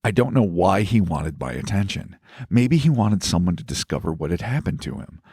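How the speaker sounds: reading a letter aloud. Acted. Reads listener's letter aloud.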